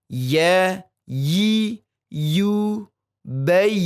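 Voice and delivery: "Ya, yi, yu, bai" with a clear middle-tongue y; ya drill. Shown as a demonstration. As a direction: chant "bai" gliding into y. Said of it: "huruf ya".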